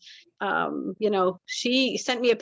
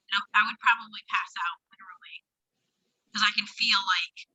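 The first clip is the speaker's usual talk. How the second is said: tinny bad phone line; breaking up over a bad connection; tin can phone; 2 semitones lower than usual. Real, not acted. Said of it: "sound like you're talking through a tin can".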